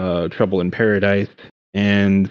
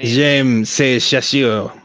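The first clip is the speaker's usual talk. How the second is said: slow, careful Duolingo-style French; slow French recitation; 3 semitones higher, much slower than usual. Pretend. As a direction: sound out a French sentence slowly like a learner.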